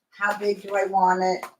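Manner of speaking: ordinary delivery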